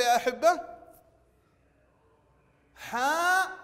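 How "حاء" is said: حاء with a long, high-pitched open aa; long alif vowel. Shown as a demonstration. Teacher showing pupils the open letter, drawn-out vowel. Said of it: "نلاحظ حرف الحاء مفتوح وبعده ألف".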